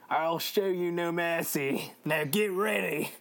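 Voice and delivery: slow, hammed-up Cockney-Australian threat; Cockney Australian accent. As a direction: menace them in rough-and-ready Australian. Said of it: "I'm doing more like Cockney Australian".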